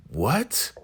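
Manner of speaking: high, rising, softened disbelief; mock shock. Brian getting strapped by the sheriff, mock shocked. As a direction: squeak it up in stunned confusion. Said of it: "Brian's getting the shock of his life".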